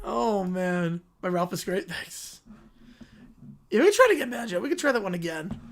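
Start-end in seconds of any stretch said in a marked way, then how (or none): none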